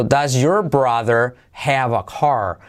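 "does your brother have a car" slowly, every sound pronounced; slow word-by-word "does your brother have a car"; separated, careful. Shown as a demonstration. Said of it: "pero así, separado".

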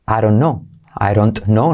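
"I don't know" as "I ron know"; dropped t. Shown as a demonstration. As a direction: flap the d, drop the t in "don't". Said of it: "la D se convierte en R suave".

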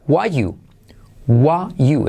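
"Why you" as "wa you", no T, no "are"; verb omitted. Shown as a demonstration. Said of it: "omitimos todo el verbo". («Why you» omitiendo todo el verbo)

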